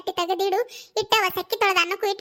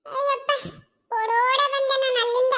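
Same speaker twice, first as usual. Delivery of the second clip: panting like a dog from running; out of breath; 5 semitones higher than usual. Acted. Messenger, breathless from running, tells the family. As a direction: gasp the words out after running. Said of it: "ನಾಯಿಗತ್ತೆ ತೇಕಾಕತ್ತಾನ".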